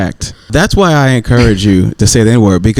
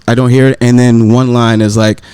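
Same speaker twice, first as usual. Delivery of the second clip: usual speaking voice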